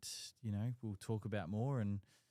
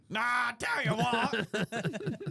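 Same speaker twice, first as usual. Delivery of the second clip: high put-on voice; 14 semitones higher, 8 dB louder, much slower than usual. Mock caller voice, high.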